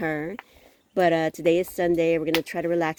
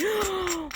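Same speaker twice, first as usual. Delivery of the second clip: high, sharp intake of breath; sharp frightened gasp; 3 dB quieter than usual. Retold or imitated. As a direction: gasp as if something just screamed. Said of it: "and I'm like".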